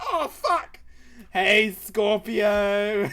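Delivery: high, loud, drawn-out hollering; excited shout. Real, not acted. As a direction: holler a stretched greeting to the raider. Greeting the raider excitedly.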